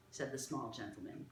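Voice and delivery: plain reading voice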